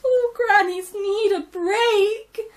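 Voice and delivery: high, loud, slow tearful wail. Acted. Gran wailing to the piglets for a break, tearful. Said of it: "Gran wailed".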